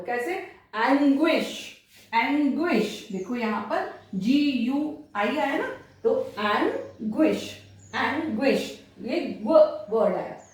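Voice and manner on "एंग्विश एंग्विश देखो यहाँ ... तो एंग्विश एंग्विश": एंग्विश with a gw in the middle, slowly; gw sound. Shown as a demonstration. Teacher modelling anguish slowly for students. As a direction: stretch out the gw of एंग्विश. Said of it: "ग्व pronounce होता है".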